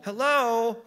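loud, high call from the street. Acted. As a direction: call out to get their attention. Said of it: "a voice called".

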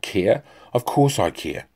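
loud indignant roar of a general; thundering. A narrator's reading. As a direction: thunder back, outraged. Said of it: "thundered Karnak".

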